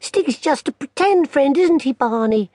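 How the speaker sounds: a big sister mocking kindly; teasing big sister. Lou voiced.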